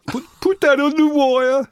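hammed-up high shout; mock order. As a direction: bark it like a frantic drummer. Voicing the tour catchphrase.

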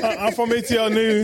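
Atlanta accent. Shown as a demonstration. Guest asked for his best Atlanta voice, playful accent. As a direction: put on an Atlanta accent with laughter in it. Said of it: "Okay, Atlanta".

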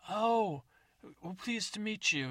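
put-on a greedy old codger eager for the carcass; old man. Old man introduces himself.

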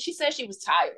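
ordinary talk

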